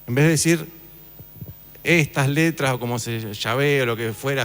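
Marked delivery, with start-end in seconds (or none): none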